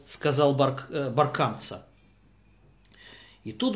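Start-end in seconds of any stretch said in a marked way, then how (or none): none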